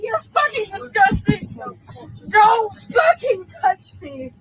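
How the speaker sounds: slow, high, crying shouts; angry tearful yelling. Real, not acted. Passenger tells people not to touch her, angry tearful yelling. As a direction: drag out each furious word through tears.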